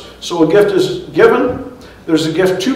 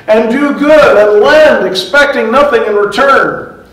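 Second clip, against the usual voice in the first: scripture quote; 5 semitones higher, 6 dB louder than usual. Acted. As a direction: proclaim "expecting nothing in return" as holy writ. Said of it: "Jesus says".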